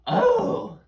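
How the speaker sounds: put-on high, loud surprised old witch; a delighted witchy oh. Witch spots the children.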